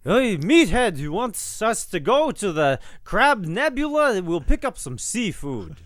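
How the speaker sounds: a Mel Brooks style dad bit; Meathead's dad impression. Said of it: "You wanna do his dad?".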